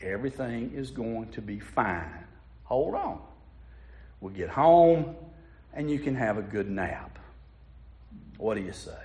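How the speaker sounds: put-on calm the child down softly; quiet mom. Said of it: "The mom quietly said".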